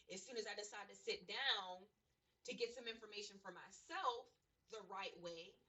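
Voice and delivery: ordinary talk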